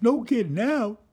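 alarmed. Retold or imitated. Teen alarmed by friend's warning.

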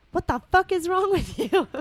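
high, loud exasperated demand; angry outburst. Acted. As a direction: explode in high-pitched indignation. Acted quarrel, Ron explodes.

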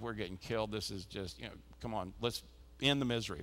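low, quiet, deflated grumble; defeated mutter. Retold or imitated. Parent in the stands grumbling about a lost game, quiet and deflated. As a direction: sigh it out like a parent watching a rout.

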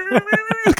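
very high-pitched mock whimper; imitated lip-quiver whimper. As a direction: whine as if fighting back tears. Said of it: "your lip is quivering".

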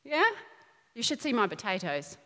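ordinary talk

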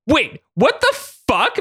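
high, slow startled yell. A narrator's reading. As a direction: shout it out in shock. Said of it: "Maddie yelled".

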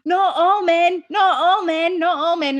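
high, slow, dragged-out groan of the dead; mock zombie moan. Dead men rising from the battlefield, moaning in a high voice. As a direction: moan it like corpses rising from the ground. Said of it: "the next one is".

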